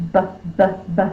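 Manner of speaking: repeated short "B, B" with the lips popping; popped b sounds. Shown as a demonstration. Teacher showing pupils how the lips pop for b. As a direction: pop the air on each B. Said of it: "My lips are closed".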